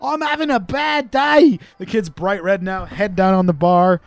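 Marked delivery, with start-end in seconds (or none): 0.0-1.6 put-on whiny Australian kid. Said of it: "He's like".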